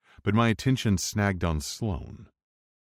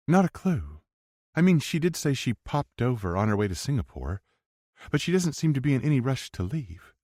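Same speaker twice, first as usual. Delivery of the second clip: lightly voiced young woman; a hint of a higher woman's voice; 7 semitones higher than usual. Emerson voiced by narrator.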